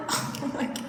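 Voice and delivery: half-laughing whisper of shock; whispered oh my God. Retold or imitated. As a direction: hush it in mock disbelief. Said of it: "And I was like".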